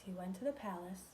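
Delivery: plain reading voice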